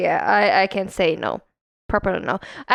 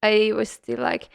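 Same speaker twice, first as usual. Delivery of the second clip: normal talking voice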